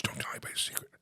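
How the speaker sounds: hushed secret whisper. Pretend. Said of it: "Okay, shh".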